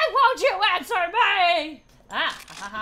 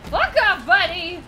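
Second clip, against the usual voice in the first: loud, raised-pitch yelling; shouted rebuff; 3 semitones higher, 6 dB louder than usual. Real, not acted. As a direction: yell to drive an attacker away. Fending off game enemy.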